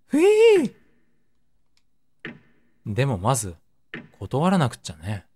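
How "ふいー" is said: high, slow hammed-up sigh with a laugh; laughing mock sigh of relief; relieved whoosh. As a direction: exaggerate the relief. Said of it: "やっと息をついた".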